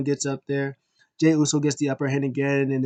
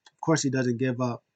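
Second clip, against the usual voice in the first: ordinary talk, nothing marked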